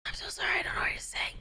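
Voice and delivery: hushed, breathy whisper of an apology. Retold or imitated. Apologising to the driver in a whisper. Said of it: "and I'm like".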